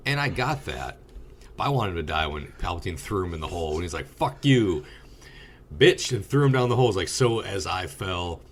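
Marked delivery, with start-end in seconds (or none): 4.1-6.1 raised, louder villain's insult; high, punchy retold curse; mock defiant taunt. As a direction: hurl it out like a gleeful taunt. Acts out villain's throw-down taunt.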